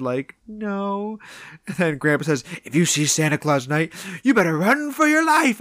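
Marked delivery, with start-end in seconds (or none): 0.5-1.2 small, high, soft imitation of a child; mock-sheepish little admission. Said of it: "sheepishly shakes his head". 2.6-5.6 mock-menacing warning leaping much higher; hammed-up creepy old man, rising to a high cry; scary grandpa. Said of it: "he's so scary".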